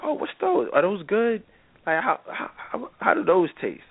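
high mock woman's voice, feigned interest; fake curious flirt. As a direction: pitch way up, play dumb and eager. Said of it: "She's like".

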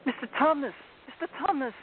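high, anguished calling out; pleading in great distress; distressed girl. A narrator's reading. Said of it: "said Lucy in great distress".

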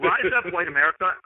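playful mock call to arms; rally the crowd in jest; sarcastic rallying cry. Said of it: "I'm saying this sarcastically".

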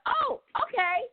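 high, slow startled compliance; suddenly meek and surprised; sheepish oh okay. Retold or imitated. Sons caving after mom yells, high and startled.

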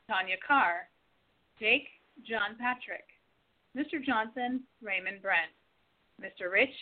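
ordinary talk, nothing marked

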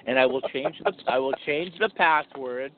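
low, measured mock menace; menacing pretend threat. Pretend husband threatening his wife over Facebook, menacing. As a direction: spell out each threat in a low voice.

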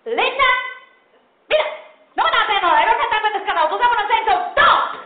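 high loud fast Spanglish rant; screaming mom. Retold or imitated. Mother screaming at her daughter in mixed Spanish and English, high and loud. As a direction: rattle it off like an angry Puerto Rican mom. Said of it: "just start screaming".

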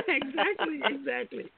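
laughing through the word; amused, laughing agreement. Real, not acted.

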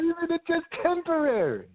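echoing the friend's question in a high mocking voice. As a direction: parrot it back to ridicule it.

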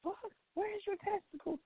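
put-on high, soft incredulous girlfriend voice; mock girlfriend. Girlfriend asks her crying man, high mock disbelief. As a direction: play the shocked girlfriend, high and hushed. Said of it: "She be like".

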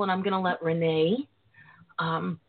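normal talking voice

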